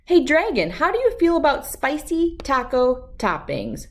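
plain reading voice

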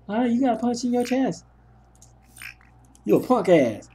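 mock him like a jeering buddy; mocking friend.

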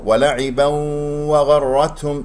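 slow tajweed chant; Quran recitation. Shown as a demonstration. Teacher reciting the verse, slow chant. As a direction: recite slowly in measured tajweed.

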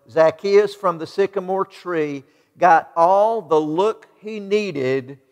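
normal talking voice

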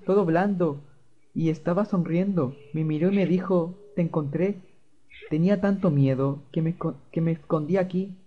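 put-on a small boy still shaken, telling what happened; scared child voice. Fluffy telling David about the man, lightly voiced scared child.

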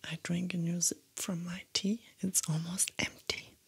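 soft whisper close to the mic; ASMR whisper. Acted. Whispering her makeup steps to ASMR viewers, soft whisper. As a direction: whisper gently, as if sitting right beside them.

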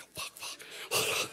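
slow whispered panic; panicked whisper. Retold or imitated. Orchestra player mid-take muttering in panic, whispered. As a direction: whisper it like a terrified musician. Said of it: "the whole orchestra shitting themselves".

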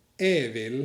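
"เอวิล" said slowly as "e vil"; dialect variant "e vil". Shown as a demonstration. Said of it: "พวกเขาจะพูด". (ออกเสียง "เอวิล" ช้าๆ)

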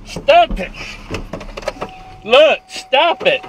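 loud, desperate protest; shouting. Real, not acted. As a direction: yell at him to stop. Driver resists arrest.

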